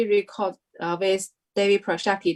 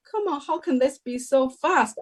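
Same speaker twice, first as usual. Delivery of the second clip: shocked; 6 semitones higher than usual. Retold or imitated. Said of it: "like, shocked".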